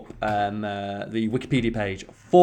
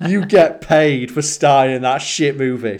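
laughing cheer; 5 semitones higher, 7 dB louder, slower than usual. Real, not acted. Laughing while praising Caine.

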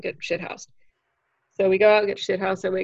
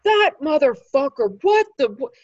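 much louder, higher outraged mock yell; incensed outburst; 6 semitones higher, 7 dB louder than usual. Club owner and Lewis outraged for the comic, loud mock yell. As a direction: sputter in outrage on her behalf. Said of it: "incensed for me".

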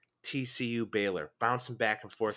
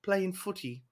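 higher, hammed-up British lad; mock British accent; 9 semitones higher than usual. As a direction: say footy like a posh Brit. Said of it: "has a British accent".

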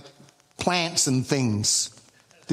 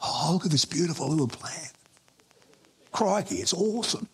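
lightly put-on Australian accent, softer; a hint of an awed Australian nature lover; father-in-law gushing over a plant, light Australian accent; 3 dB quieter than usual. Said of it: "He's not Australian".

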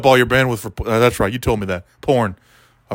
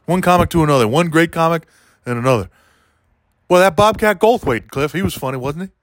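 usual speaking voice

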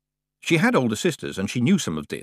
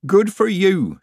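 loud, high-pitched cheer; hearty approval; 11 semitones higher, 6 dB louder, slower than usual. A narrator's reading. Nanny congratulating Tiffany after a bawdy laugh, hearty. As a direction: cheer her on with gusto.

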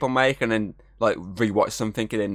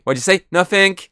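higher, louder imitation, jumping up on the denial; mock innocent exchange; 9 semitones higher, 6 dB louder than usual. Host re-enacting the cartoon's awkward exchange, high and loud. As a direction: act out a caught-out denial, higher.

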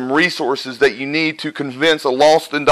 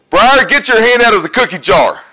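higher, louder parental yell; mock yell at a kid; 7 semitones higher, 5 dB louder than usual. Preacher acting a parent yelling at Briar, mock yell. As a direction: bark it like a fed-up parent. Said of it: "when you yell at a kid".

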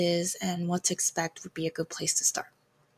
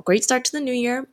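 sarcastic; 6 semitones higher, 4 dB louder than usual. Real, not acted. Admits missing the upload deadline.

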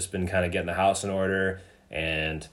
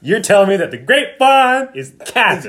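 much higher and louder incredulous; mock disbelief; 12 semitones higher, 10 dB louder than usual. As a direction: act shocked at the news. Mock outrage at rumor.

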